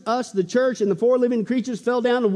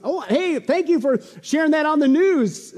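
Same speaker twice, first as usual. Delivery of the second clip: higher-pitched pretend gratitude; mock delighted thanks; 8 semitones higher, 4 dB louder, slower than usual. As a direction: act pleasantly surprised by the news. Mock thanks to news networks.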